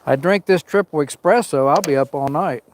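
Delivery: ordinary talk, nothing marked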